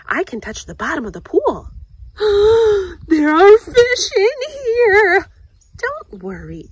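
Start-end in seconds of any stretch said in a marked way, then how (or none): 1.7-3.0 sharp high gasp; startled gasp. Acted. Girl startled by fish in the lake, sharp gasp. 3.1-5.3 high, loud, slow wail; frightened cry. Acted. Scared girl spotting fish in the lake, high wailing cry.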